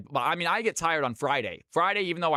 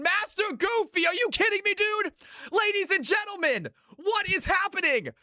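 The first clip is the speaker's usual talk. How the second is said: much higher, sustained excited streamer yell; ecstatic hype shout. Real, not acted. Thanking Goofy for gifted subs on stream, ecstatic high-pitched hype shout. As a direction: gush over the gift like it's unbelievable.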